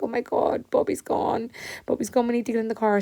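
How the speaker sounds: put-on kids' panic. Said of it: "they were like".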